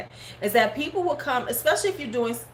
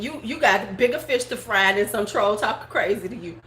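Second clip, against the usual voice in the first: ordinary talk, nothing marked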